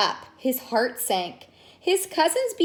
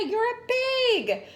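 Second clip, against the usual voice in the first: high, slow, drawn-out pig; a mock dramatic cry; cried out; 12 semitones higher, slower than usual. Caramba insisting to Portia, high cry. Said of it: "cried Caramba".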